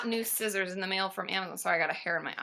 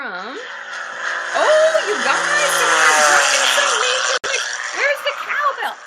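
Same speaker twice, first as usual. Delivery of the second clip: much louder, high, excited outburst; thrilled outburst; 10 semitones higher, 12 dB louder, slower than usual. Real, not acted. Reacting to a viewer's big donation, loud and thrilled. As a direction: blurt it out like a big surprise.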